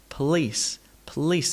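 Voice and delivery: police as p'LEECE; vanishing schwa. Shown as a demonstration. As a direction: let the first vowel of police almost disappear. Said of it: "It almost disappears".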